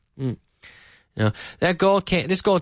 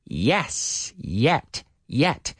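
normal talking voice

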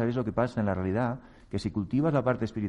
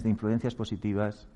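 ordinary delivery

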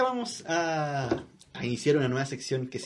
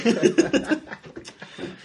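breathy held-in giggle; trying not to laugh out loud; stifled giggles; 13 semitones higher, 4 dB louder than usual. Real, not acted. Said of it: "¡No te rías!".

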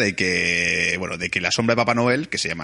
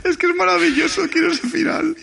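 high, loud delighted gush; a podcaster thrilled by a friend's movie ending, gushing; excited; 3 dB louder than usual. Real, not acted. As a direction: squeal with delight over the idea.

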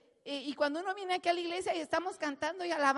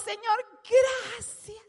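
higher, louder cry of thanks; 7 semitones higher, 7 dB louder, much slower than usual. Retold or imitated. Healed man cries thanks to Jesus, loud. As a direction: shout your gratitude upward. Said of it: "a grande voz".

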